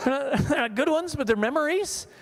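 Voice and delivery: normal talking voice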